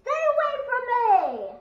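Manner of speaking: piercingly high, loud yell; warning shout. Real, not acted. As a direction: scream at them to keep off. Warning the other player off.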